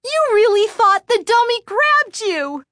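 put-on high loud gloating girl; mock her sister gleefully; teasing little sister. A narrator's reading. Voices Lindy teasing.

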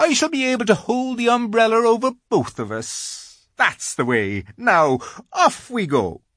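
a nervous little faun asking politely; timid polite faun. A narrator's reading. Faun politely questions the girl, high and fussy.

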